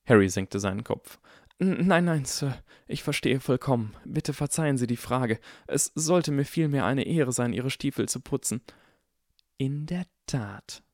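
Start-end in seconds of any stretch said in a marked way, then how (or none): 1.6-8.6 put-on higher, stammering deferential boy; obsequious Harry. 9.6-10.8 slow, drawn-out hiss, softly voiced. A narrator's reading. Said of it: "zischte Draco".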